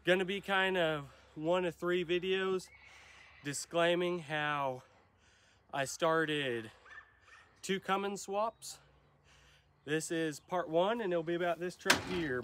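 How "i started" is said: usual speaking voice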